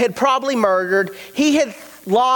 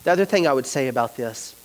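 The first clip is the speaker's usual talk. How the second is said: soft reflective preaching; 8 semitones lower, 6 dB quieter than usual. Acted. Preacher closing the message to the congregation, hushed and intimate. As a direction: lower and softer, the sermon drawn inward. Quiet closing sermon stretch.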